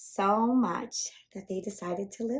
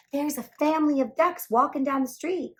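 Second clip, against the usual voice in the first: excited policeman; 7 semitones higher, 7 dB louder than usual. Acted. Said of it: "He called Clancy at headquarters and said".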